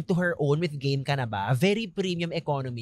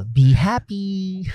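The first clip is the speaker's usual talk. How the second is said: slow drawn-out sign-off; Bernadette Sembrano sign-off; 3 semitones higher, 4 dB louder, much slower than usual. Retold or imitated. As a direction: stretch out a cheery sign-off. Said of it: "Sa Bernadette Sembrano YouTube channel".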